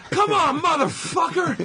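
very high-pitched mock yell to a crowd; Jericho. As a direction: imitate a wrestler goading a hushed crowd. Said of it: "So Jericho would do, be a hushed crowd".

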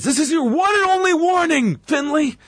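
high-pitched mock referee shout; imitated yelling. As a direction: yell a stern warning, imitating an angry referee. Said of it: "He yells at him".

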